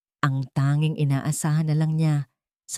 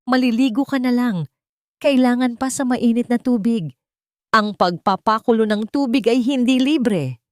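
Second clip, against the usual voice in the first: shrill complaint about the heater bill; scolding shout; 6 semitones higher than usual. A narrator's reading. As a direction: bark it at her like a stingy mother-in-law. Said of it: "Hindi dahil sa pagsigaw nito".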